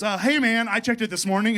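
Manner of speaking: high, loud exasperated reply; mock impatient brush-off; frustrated. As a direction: imitate an irritated instructor. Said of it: "he seems a little frustrated".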